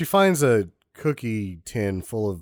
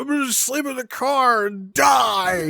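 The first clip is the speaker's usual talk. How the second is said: mock sulking whine; 3 dB louder, slower than usual. Mocking sulky character.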